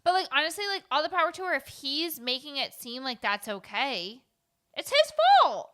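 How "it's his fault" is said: shouted, pitched way up; high loud outburst. Real, not acted. Heated point about Bill.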